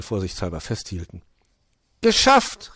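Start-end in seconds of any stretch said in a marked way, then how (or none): 2.0-2.7 very high, loud shout; exultant pitched-up cry; triumphant call. A narrator's reading. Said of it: "rief sie".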